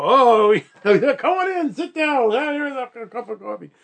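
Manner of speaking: high, delighted, fawning host voice; overeager broker welcome. Retold or imitated. Broker greets a rich-seeming customer with eager high delight. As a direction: gush over them like a big spender walked in.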